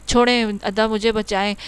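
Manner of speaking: plain reading voice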